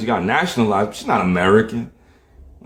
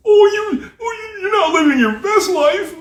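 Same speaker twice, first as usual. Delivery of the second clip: very high whiny falsetto; mocking critic. Critics answering his truth talk, mocking falsetto. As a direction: mimic a naysayer in a silly squeal.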